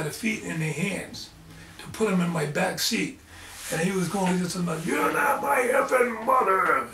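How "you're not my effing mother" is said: put-on a possessed child's snarl, not a kid's voice; demonic voice. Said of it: "the voice wasn't a kid's voice".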